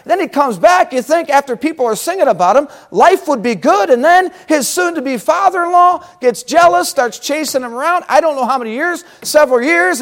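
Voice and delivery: ordinary talk, nothing marked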